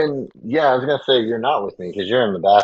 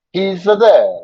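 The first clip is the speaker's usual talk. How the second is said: higher and louder, the voice swinging up and down on "He's there"; oscillating Italian voice; 10 semitones higher, 5 dB louder than usual. Shown as a demonstration. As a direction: let the pitch bob up and down like an Italian gnome. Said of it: "have your voice kind of oscillate back and forth".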